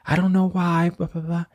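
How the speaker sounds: high, put-on whiny protest; mock defensive yelling. Student yelling back at teacher in the hallway, mock defensive. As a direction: imitate a kid snapping back defensively. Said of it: "And he's, like, yelling".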